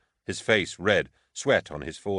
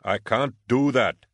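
higher and louder, slowed, horrified; exclaimed; 5 semitones higher, 4 dB louder than usual. Acted. Flustered guard refusing to hand prisoner over, exclaiming. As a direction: blurt it out, appalled at the idea. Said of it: "Zamak exclaimed".

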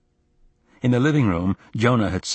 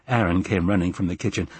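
usual speaking voice

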